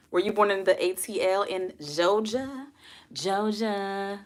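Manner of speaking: drawled Southern "Georgia"; playful Southern accent. Pretend. Streamer teases viewer about being born in Georgia, Southern accent. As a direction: put on a Southern "Georgia", dropping the r.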